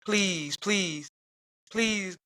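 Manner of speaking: retold pleading, each please falling; begging. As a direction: imitate a man begging with repeated pleas. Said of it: "he's begging and begging".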